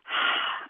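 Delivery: unvoiced, breathy sigh of attraction; swooning sigh. Real, not acted. Remembering the minute she met her partner, swooning sigh.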